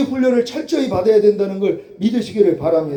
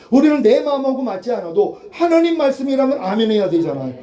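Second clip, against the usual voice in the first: ordinary talk, nothing marked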